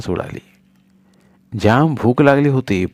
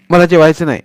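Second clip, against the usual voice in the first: shouted refusal; 7 semitones higher, 6 dB louder than usual. Acted. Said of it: "सोन्या ओरडला".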